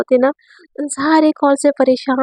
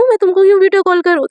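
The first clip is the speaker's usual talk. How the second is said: indignant refusal; 6 semitones higher, 3 dB louder, faster than usual. Acted. Teacher resists student's demand.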